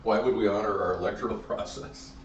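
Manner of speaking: ordinary talk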